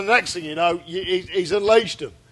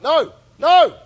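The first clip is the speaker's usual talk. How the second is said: loud panicked no; mock panicked no; 10 semitones higher, 9 dB louder than usual. Cohost dodging the question, panicked mock shout. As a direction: blurt it out in panic.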